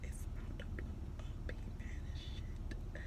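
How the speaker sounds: gossipy whisper aside. Retold or imitated. Jackson whispering to Harley, secret gossip. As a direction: lean in and whisper the news. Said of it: "he's all hitting at Harley like".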